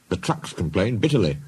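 ordinary delivery

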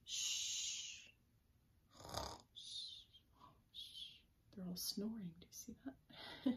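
hushed bedtime whisper. Acted. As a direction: hush so they stay asleep. Hushing, dogs asleep.

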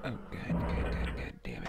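breathless whispered cursing; frightened whisper. Real, not acted. Scared player cursing in a dark room, whispered. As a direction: gasp it out under your breath.

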